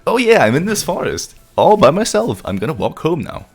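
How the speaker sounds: high, sing-song mock cheerfulness; hammed-up wanderer. Imitating a friend lost in the forest, mock carefree. Acts out friend's drunken walk home.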